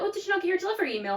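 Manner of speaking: mimicking the shop staff, high and fast; fast high mock voice. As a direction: rattle it off in a high breezy tone. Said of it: "they were like".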